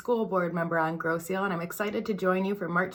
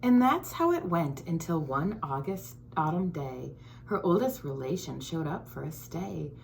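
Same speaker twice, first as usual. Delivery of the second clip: put-on lively storybook read-aloud for kids; reading to children; 4 dB quieter than usual. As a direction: read it like story time for little ones. Reads picture book to children.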